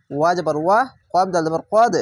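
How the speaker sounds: usual speaking voice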